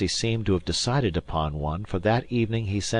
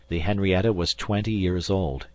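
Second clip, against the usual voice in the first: plain reading voice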